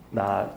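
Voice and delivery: 나 said low and soft. Shown as a demonstration. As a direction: pitch the syllable 나 down low. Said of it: "낮게".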